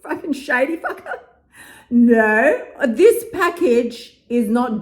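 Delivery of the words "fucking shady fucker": words broken by her own laugh; laughing disbelief. Real, not acted. Laughing to the viewers about the shady guy. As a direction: laugh through the insult in disbelief.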